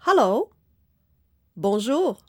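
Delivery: ordinary talk, nothing marked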